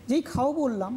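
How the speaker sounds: normal talking voice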